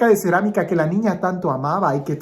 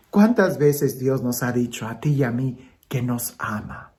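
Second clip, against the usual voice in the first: normal talking voice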